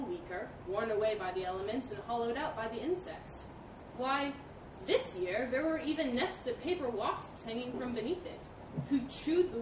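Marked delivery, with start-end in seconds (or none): none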